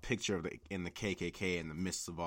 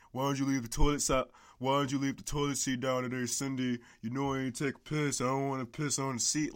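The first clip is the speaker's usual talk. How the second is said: high, fast put-on pleading voice; mock sweet request; 4 semitones higher than usual. Man asking Cindy to leave the seat down, mock sweet. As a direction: ask nicely in a silly voice. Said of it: "You know, like".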